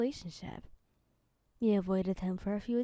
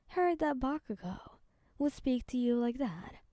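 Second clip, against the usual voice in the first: usual speaking voice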